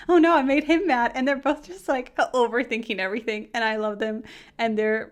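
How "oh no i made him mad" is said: put-on higher, louder flustered girl; lightly voiced anxious Toru. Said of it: "Toru is like".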